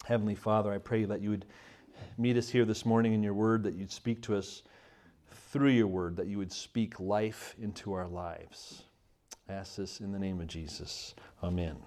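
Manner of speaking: spoken prayer. Acted. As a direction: pray gently before the congregation. Leads the congregation in prayer.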